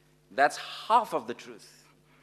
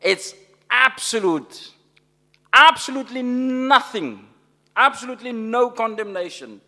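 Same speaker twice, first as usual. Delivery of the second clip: absolutely punched higher and louder; rising preacher's emphasis, louder; emphatic; 7 semitones higher, 8 dB louder than usual. Real, not acted. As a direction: hammer each absolutely home. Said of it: "It's emphatic".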